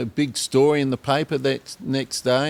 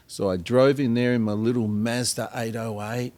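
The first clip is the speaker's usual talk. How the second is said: normal talking voice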